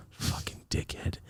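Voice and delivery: slow, whispered curse; very quiet retold grumble; muttered insult. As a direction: mutter it under your breath. Muttered insult at man in gym.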